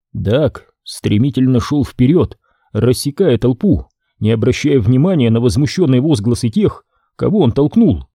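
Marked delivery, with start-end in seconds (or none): none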